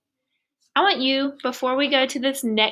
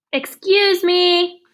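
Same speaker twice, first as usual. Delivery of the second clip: slow, higher and louder giant whale; booming whale voice; 8 semitones higher, 7 dB louder, much slower than usual. Acted. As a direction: loom over the little ones. Whale speaks in story.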